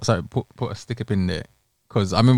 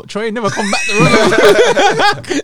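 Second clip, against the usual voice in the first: mock shout; 11 semitones higher, 7 dB louder, much slower than usual. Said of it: "He's like".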